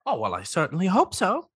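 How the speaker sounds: lightly voiced lonely crab; a hint of a needy crab; crab character voice.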